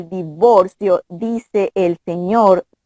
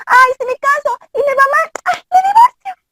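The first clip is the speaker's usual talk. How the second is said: much higher, airy mock voice of a careless girl; mocking flighty girl. Mocking to Dayana how flighty girls talk about divorce, high mock girl voice. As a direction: parody young women shrugging off divorce.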